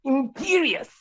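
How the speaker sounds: high-pitched villain's spell; Voldemort. Acted. Teaching friend Voldemort's spell, high villain incantation. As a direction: cast it in a high mock-villain voice. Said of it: "how does he talk in the movies?".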